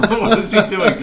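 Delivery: high, loud, laughing; laughing disbelief. Retold or imitated. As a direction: ask it while cracking up. Stunned classmates retold, laughing.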